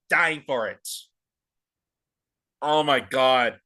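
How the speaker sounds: normal talking voice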